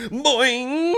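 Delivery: boing sound. Pretend. Sex joke sound effect.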